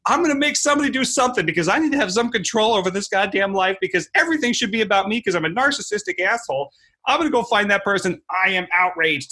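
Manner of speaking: high sneering parody of a furious keyboard warrior; mocking outraged troll. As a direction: lampoon a petty narcissist working himself into outrage. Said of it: "they're gonna run around and go".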